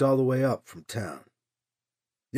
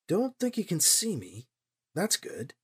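softer, under the breath to himself; muttered aside; 7 dB quieter than usual. A narrator's reading. Hiding, talking to himself.

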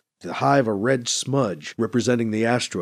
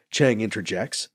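ordinary delivery

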